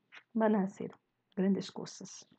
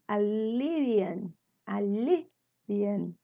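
"alivian" said slowly and carefully; slow drilled answer; much slower than usual. Shown as a demonstration. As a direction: spell it out slowly for the class. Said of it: "teacher drills the verb".

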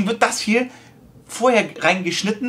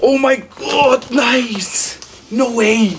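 excited; 5 semitones higher, much slower than usual. Real, not acted. Sponsor package revealed.

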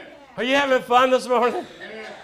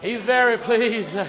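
out of breath; slower than usual. Real, not acted. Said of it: "I'm out of breath".

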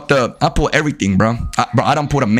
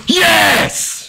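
triumphant yell; 14 semitones higher than usual. Real, not acted. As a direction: celebrate at the top of your lungs. Celebrating the clip.

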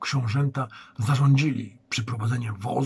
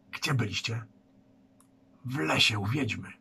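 hushed question; 5 dB quieter than usual. Acted. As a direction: ask under your breath so others won't hear. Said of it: "Borek szeptem spytał Sambora".

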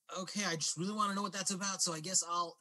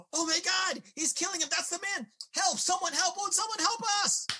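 high, loud screams for help; panicked; 13 semitones higher, 4 dB louder than usual. Acted. Woman from the diner calling for help, panicked. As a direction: shriek for anyone to come and help.

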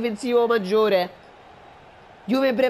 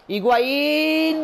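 excited call; 7 semitones higher than usual. Acted. Shot on goal call.

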